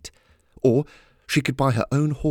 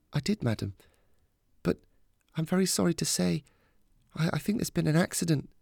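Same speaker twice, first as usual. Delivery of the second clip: soft, higher voice breaking bad news gently; carefully calm; 8 semitones higher, 4 dB quieter than usual. A narrator's reading. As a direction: keep it calm and quiet, with hesitant pauses. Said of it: "keep her voice as calm as possible".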